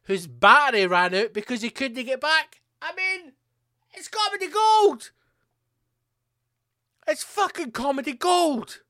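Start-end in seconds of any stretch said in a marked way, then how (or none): 2.8-8.8 very high, delighted squeal; gleeful exclamation. Real, not acted. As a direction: exclaim it in incredulous glee. Delighted by the absurd news story.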